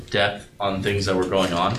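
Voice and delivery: ordinary talk, nothing marked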